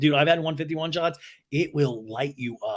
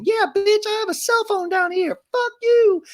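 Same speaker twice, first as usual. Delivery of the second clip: very high, loud squeal of defiance; over-the-top shrill imitation, yelled; mock high-pitched yell; 6 dB louder than usual. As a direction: shriek it like a tiny furious brat. Mock outburst from a texting cast member.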